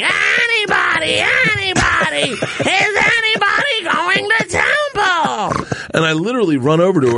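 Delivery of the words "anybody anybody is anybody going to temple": very high, slow mock wail; real whiny. Dream friend pleading over the cubicles, whiny wail. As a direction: whine it out, dragging every word. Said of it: "Like, real whiny".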